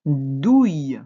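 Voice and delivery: loud, drawn-out dictated syllable; dictation. Shown as a demonstration. Teacher dictating the first syllable to a pupil, loud and drawn out. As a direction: call the syllable out for writing down. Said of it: "La troisième syllabe".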